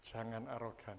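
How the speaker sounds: very low, soft rebuke; quiet, low mock warning; hushed admonition. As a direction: drop right down and murmur it. Mock admonition after a boast.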